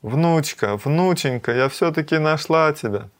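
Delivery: high-pitched, elated reunion cry; overjoyed. A narrator's reading. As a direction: exclaim it, beside yourself with happiness. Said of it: "обезумела от счастья".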